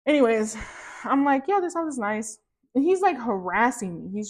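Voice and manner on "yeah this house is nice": higher, airy "yeah"; mock casual yes; half-hearted agreement. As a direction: give in lightly. Agreeing with husband, high.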